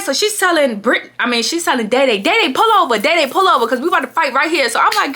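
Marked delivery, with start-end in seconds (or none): 2.2-4.7 mock demand. Friend demanding driver pull over.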